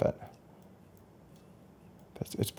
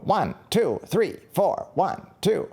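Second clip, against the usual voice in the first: higher, robotic metronome count; Doctor Beat count; 7 semitones higher, slower than usual. Retold or imitated. Recalling the Doctor Beat metronome, mock machine count. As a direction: mimic the old drum machine barking out the beat. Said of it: "those Doctor Beats that would yell at you".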